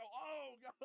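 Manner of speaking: high, loud, hammed-up gasp of dread; mock horror. Putin hearing the bad news, hammed-up high loud horror. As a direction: play Putin's comic terror. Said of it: "He would go".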